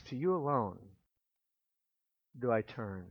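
very soft, low voice of total dependence; hushed prayer. Acted. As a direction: pray it quietly, as if nothing else is left. Voicing the psalmist's desperate prayer.

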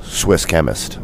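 ordinary talk, nothing marked

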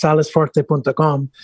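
Salesforce said the Spanish way, extra syllables sounded out; Spanish pronunciation. Shown as a demonstration. American salesman showing how Spain says the company name, Spanish pronunciation. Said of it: "in Spanish is pronounced".

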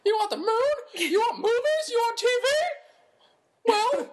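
very high, excited movie-character impression; hammed-up, squawky old-film hero voice; George Bailey. Co-host performing her one voice impression on request, hammed-up impression. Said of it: "the one voice impression that you know how to do".

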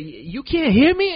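high, loud, drawn-out mock question; retold loud disbelief. Man retelling his realization that she can't hear, loud disbelief. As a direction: ask it slowly and loudly, incredulous.

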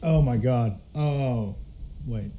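ordinary delivery

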